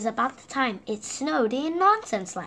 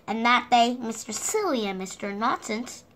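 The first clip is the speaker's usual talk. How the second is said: usual speaking voice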